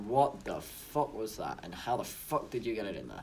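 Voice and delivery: put-on slow, low gruff northern English accent. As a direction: drawl it like a Northern English bloke. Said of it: "now what the hell is that accent?".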